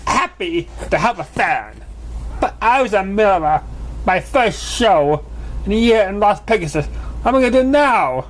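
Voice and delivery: high, rising panic of a showmare; a diva whose big show was ruined; Trixie, distressed. Acted. Trixie frets to Pinkie about her missed show, in a high, rising, distressed voice.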